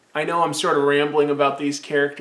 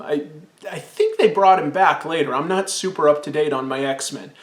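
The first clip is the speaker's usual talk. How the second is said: normal talking voice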